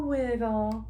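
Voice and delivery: ordinary delivery, nothing marked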